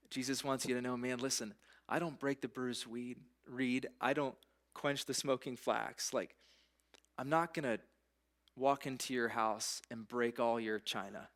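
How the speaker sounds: ordinary talk, nothing marked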